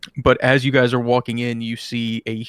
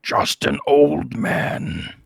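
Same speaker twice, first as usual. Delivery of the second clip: nasty booming voice; 3 semitones higher, much slower than usual. Acted. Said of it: "nasty booming voice come out of it and says".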